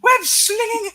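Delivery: high, loud squealing aunt rant; J J's rant in Aunt May's voice. Acted. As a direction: rant like a shrieking old aunt. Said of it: "J J's voice done in Aunt May's voice".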